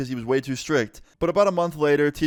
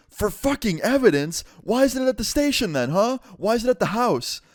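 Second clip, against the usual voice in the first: much higher, heated disbelief; incredulous anger; 10 semitones higher than usual. Retold or imitated. Son scoffing at his dad's evidence excuse, incredulous. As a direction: tear his excuse apart.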